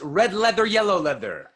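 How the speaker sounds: red leather, yellow leather crisp and loud; bright tongue twister demo; clear demo. Shown as a demonstration. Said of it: "Next one is".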